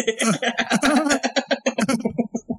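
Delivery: long high laughter. Real, not acted. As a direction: laugh helplessly. Keeps laughing.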